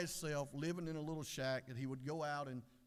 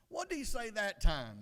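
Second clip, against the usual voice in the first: loud, higher-pitched demand; exasperated wife; 7 semitones higher, 8 dB louder than usual. Acted. Wife asks her husband yet again, loud and exasperated. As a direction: demand a repeat, fed up with missing it. Wife misses it a third time.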